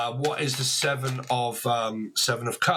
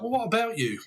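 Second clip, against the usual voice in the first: higher, mock-defensive comeback; dismissive retort; 8 semitones higher than usual. Reward-chaser brushes off the partner, higher mock-defensive retort. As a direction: toss it back at the partner, lightly snippy. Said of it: "And you're kinda like".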